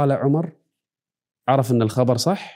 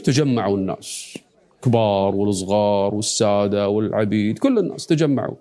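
ordinary talk, nothing marked

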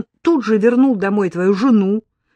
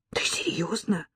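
shocked breathy whisper; whispering on the phone; 9 dB quieter than usual. Acted. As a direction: whisper it in disbelief.

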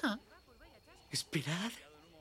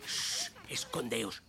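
soft, low hushing; hushed; 10 semitones lower, 5 dB quieter than usual. Acted. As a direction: hush everyone while hiding. Hiding, hushing everyone.

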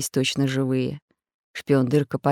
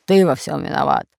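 growling pirate; 3 dB louder than usual. Acted. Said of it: "прорычал Бульбуль".

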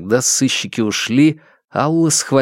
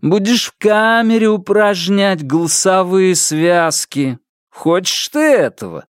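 higher, drawling, stoned sarcasm; Алик, mocking brother; 8 semitones higher than usual. A narrator's reading. Stoned brother scolds Алла on the phone, drawling mockery.

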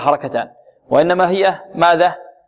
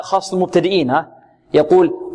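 ordinary talk, nothing marked

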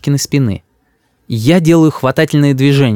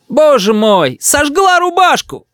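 high-pitched wail of dismay; crying out; slower than usual. A narrator's reading. Grandmother sees the burnt shirt, crying out. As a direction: cry out high, drawing it out. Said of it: "кричит бабушка".

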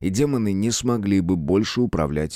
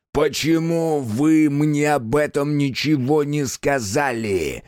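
slow menacing hiss, higher; 6 semitones higher, slower than usual. A narrator's reading. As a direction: hiss it with fury. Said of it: "шипел Сатана".

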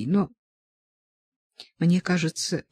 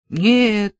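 a short grumbled no, a little louder; grumbling; 4 semitones higher, 6 dB louder than usual. A narrator's reading. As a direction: grumble the refusal. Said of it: "проворчал он". (ворчливо, чуть громче)

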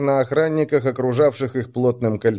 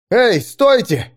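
high, slow shout; 11 semitones higher, 5 dB louder, slower than usual. A narrator's reading. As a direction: yell to stop them. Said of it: "выкрикнул он".

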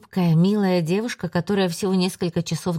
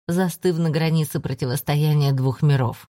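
usual speaking voice

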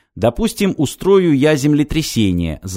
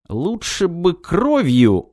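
slow, hesitant devil voice; 7 semitones higher, slower than usual. A narrator's reading. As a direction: hedge it, reluctantly, slowly. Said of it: "замялся чёрт".

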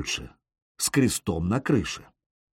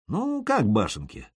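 ordinary delivery

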